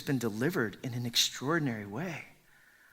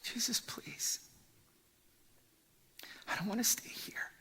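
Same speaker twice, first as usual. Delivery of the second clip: higher, hushed, anguished whisper; 8 semitones higher, 9 dB quieter than usual. Acted. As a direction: let the longing break through a whisper. Preacher voices the man's anguish.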